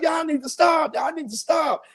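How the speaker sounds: high shouted plea to stop fighting; yelling to stop. Retold or imitated. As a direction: yell at the brawlers to quit. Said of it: "And she's like".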